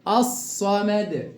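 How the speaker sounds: الصمد slowly with a long emphatic double s; lam merged into s. Shown as a demonstration. Teacher shows the merging, slow doubled emphatic s. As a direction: hold the ص in الصمد, lam silent. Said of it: "أضغمت اللام في الصاد".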